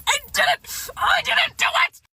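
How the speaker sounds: very high, loud squealed protest; shouted denial. Retold or imitated. Denying the rumor to her date who cancels, high shrieked protest. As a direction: shriek your innocence in exasperation.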